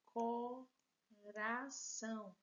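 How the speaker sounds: drawn out, each syllable separated; slow syllable-by-syllable reading. Shown as a demonstration. Teacher dictating a word to pupils, syllables slowly separated. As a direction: read it slowly for the class to count syllables. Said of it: "A próxima palavra é a palavra coração".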